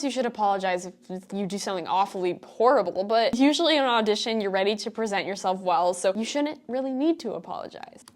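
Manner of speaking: ordinary delivery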